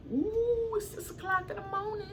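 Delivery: shoots way up high at the time; real startled squeak; sudden high-pitched "ooh" of surprise; surprised squeal. Real, not acted. Notices the late hour.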